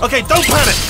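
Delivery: high-pitched, flustered self-reassurance; high, rattled urging; panicking. Acted. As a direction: try to calm everyone while panicking. Power-up starts by mistake.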